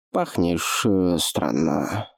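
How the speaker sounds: slushy sh for s, slow; lisping old voice. Acted. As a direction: lisp every s like sh. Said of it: "раздался над ухом шепелявый голос".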